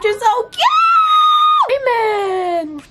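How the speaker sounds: very high, loud, slow squeal; squeal of delight. Real, not acted. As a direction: shriek with joy over the puppy. Squealing over the puppy.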